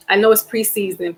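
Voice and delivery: ordinary talk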